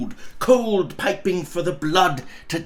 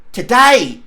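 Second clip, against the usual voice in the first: put-on boy's reply; 6 semitones higher, 9 dB louder than usual. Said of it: "replied the boy".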